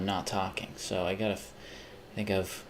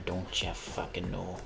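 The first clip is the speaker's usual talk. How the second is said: put-on Midwest accent; 2 semitones lower, 8 dB quieter than usual. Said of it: "the Midwest accent".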